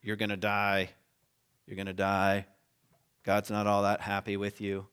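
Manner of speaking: low, grim prophet's warning, lightly voiced; a hint of a reluctant prophet proclaiming doom; Jonah's doom warning. Jonah warning Nineveh of God's judgment, low grim proclamation. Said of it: "He starts going around saying".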